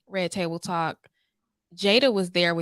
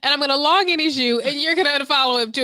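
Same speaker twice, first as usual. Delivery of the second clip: mock bossy friend; 8 semitones higher, 6 dB louder, faster than usual. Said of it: "Chanel be like".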